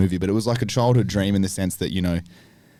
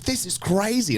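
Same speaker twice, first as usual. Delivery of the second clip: much higher, falling "This is crazy." as firm agreement; excited confirmation; 11 semitones higher, slower than usual. Retold or imitated. Say "This is crazy." high and falling, as a statement. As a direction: veteran actor confirming the set is wild, high excited tone. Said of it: "He's like".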